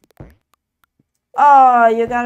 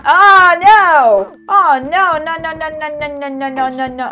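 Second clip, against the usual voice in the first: shouted oh no, fading to mutter; loud dismay; 2 semitones higher, 9 dB louder than usual. Real, not acted. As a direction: cry out then trail off. Setback in the game.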